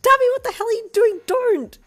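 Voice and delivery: very high, loud panicked yell; alarmed holler. Acted. Smacking Darby hands off the window, alarmed holler. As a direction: shriek at him to let go. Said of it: "you hollered".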